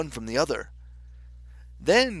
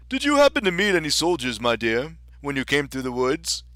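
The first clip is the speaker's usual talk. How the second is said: louder storybook king; 5 dB louder than usual. Acted. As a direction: voice a hearty, delighted king.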